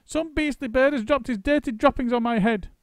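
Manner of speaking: put-on high, loud, outraged old woman; disgusted Missus Twit. A narrator's reading. Missus Twit raging at birds to husband. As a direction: spit it out in disgust.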